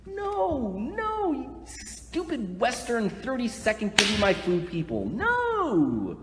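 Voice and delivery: high, drawn-out cries of no; loud, high exasperated rant; exasperated scolding. Acted. Preacher scolding thirty-second Western people, exasperated scolding.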